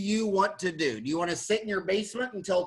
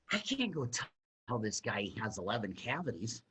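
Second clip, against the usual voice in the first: much softer, lower, holding back; hushed reluctant retort; 9 semitones lower, 11 dB quieter than usual. Retold or imitated. To his surgery instructor, a hushed reluctant protest. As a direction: quietly balk at telling the patient. Telling mentor he won't break news.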